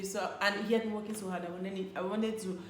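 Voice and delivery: normal talking voice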